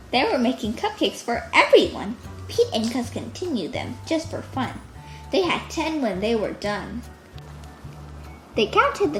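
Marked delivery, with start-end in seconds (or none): none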